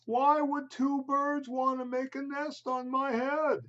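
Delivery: a baffled, put-upon elephant; Gerald the elephant. Acted. Elephant asking his friend Piggy, high and baffled. Gerald voiced in picture book.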